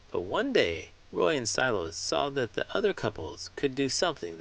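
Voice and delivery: put-on warm, lively read-aloud for young kids; storybook narration. A narrator's reading. Host reading a picture book to children, lively storybook narration. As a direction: tell the penguin story to a room of children.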